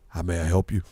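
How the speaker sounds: put-on very low clerk in an American accent; clerk's American accent. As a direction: switch to a deep, composed American accent. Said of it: "He had air accent".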